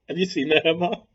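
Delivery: usual speaking voice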